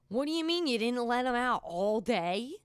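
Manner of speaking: loud, indignant retold outburst; mad. Confronting family who left the dog in, loud and mad. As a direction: raise your voice in outraged disbelief, re-enacting it. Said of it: "I remember being mad".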